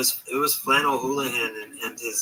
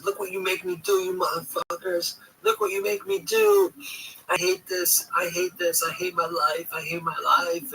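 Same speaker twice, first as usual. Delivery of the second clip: hammed-up furious complaint, voice pitched up; retold raging rant; 6 semitones higher than usual. Streamer imitating a rival raging every night at him. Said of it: "he was raging every night".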